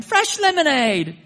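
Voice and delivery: high, loud Cockney street vendor's cry; Cockney accent. Retold or imitated. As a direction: hawk it like a cheerful Cockney kid. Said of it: "Had a Cockney accent".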